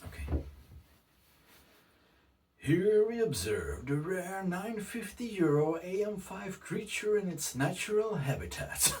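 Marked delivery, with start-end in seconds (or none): 2.6-8.9 high, hushed nature narrator voice; nature documentary. Pretend. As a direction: play the wildlife show host watching a rare creature. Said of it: "That's not my real voice, just kidding".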